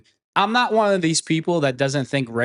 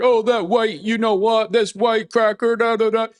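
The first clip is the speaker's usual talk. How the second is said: higher, mock-whiny impression of hurt players; mocking whine; 6 semitones higher than usual. Mocking players whose feelings are hurt, high whiny mock voice. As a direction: whine it up high like a sulking rival. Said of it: "your feelings are hurt".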